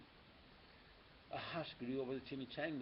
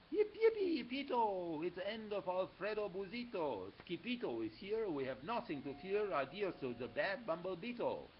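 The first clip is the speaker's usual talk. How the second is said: cheering dogs; 6 semitones higher, 4 dB louder than usual. A narrator's reading. Dogs cheer Skipito's arrival.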